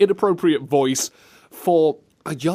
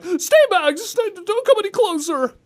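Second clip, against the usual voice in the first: high mock panicked plea; imitating the game line; 4 dB louder than usual. Retelling the game line, mock scared and high. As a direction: squeak it out like the scared kid. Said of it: "You know the person who was like".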